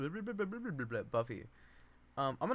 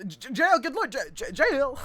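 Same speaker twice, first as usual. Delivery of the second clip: much higher, loud stuttering shock; shocked stammer; 13 semitones higher, 11 dB louder than usual. Acted. Meg hearing Babe is in jail, loud shocked stammer. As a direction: stammer the word out in disbelief.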